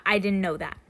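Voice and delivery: ordinary talk, nothing marked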